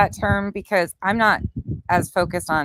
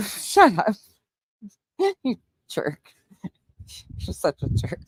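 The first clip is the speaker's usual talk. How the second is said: laughing; 4 semitones higher than usual. Real, not acted. Laughing at boyfriend's teasing.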